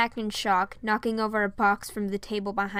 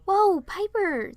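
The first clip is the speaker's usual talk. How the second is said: gentle whoa; 10 semitones higher, 5 dB louder, much slower than usual. A narrator's reading. Princess soothes panicking child.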